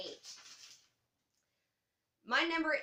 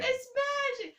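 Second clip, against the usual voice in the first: mock magician; 5 dB louder, slower than usual. Quoting the catchphrase.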